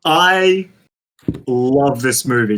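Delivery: ordinary talk, nothing marked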